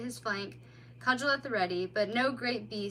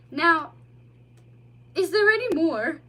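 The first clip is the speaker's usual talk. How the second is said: put-on high, loud childlike voice; young otter; 6 semitones higher, 10 dB louder than usual. A narrator's reading. Otter describing his journey to the mole, childlike. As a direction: tell of the long trip wide-eyed.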